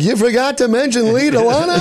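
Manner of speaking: high incredulous sitcom whine; Jerry Seinfeld. Retold or imitated. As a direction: play the exasperated sitcom host. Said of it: "where Jerry's like".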